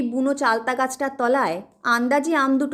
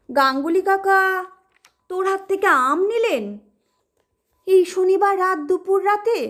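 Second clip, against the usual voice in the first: frightened disbelief; 6 semitones higher than usual. Acted. Terrified mother asks.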